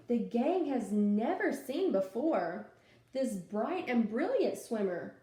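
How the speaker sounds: lively storytime read-aloud; animated picture-book reading; reading to children. Acted. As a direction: read it out to a class of little kids.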